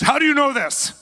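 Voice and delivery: high, loud astonished demand; shocked and demanding an answer; stunned shout. Retold or imitated. Dictator demanding how the preacher knew, high astonished shout.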